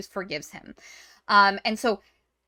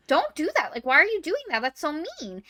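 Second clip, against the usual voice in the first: put-on higher, pleading girl's protest; mock whiny Maria; 7 semitones higher than usual. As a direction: act out a kid scolding a friend, pitched up. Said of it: "And Maria's like".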